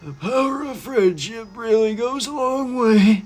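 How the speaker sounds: high, slow, put-on cheesy voice; exaggerated high-pitched mockery of game dialogue; mock friendship speech. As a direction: parody a cringey friendship line in a high voice. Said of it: "It's like".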